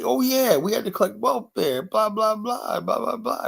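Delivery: high, offhand mother's voice. Retold or imitated. Mother telling her son about welfare, high offhand voice.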